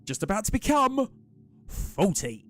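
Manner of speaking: excited. Real, not acted. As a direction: cheer the milestone for the viewer. Cheering a friend's subscription count.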